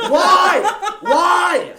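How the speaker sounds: loud, high, laughing why; mock outrage at security; incredulous yell. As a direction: shout it in disbelief. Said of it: "I'm like".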